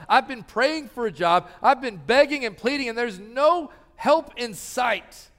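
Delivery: imitated discouraged prayer, pressed and urgent; desperate pleading prayer. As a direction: beg God like someone worn out by waiting.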